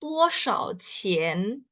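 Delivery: "Duo shao qian?" said very slowly, syllable by syllable; slow Mandarin. Shown as a demonstration. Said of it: "kalian bisa menanyakan". (pelafalan Mandarin pelan)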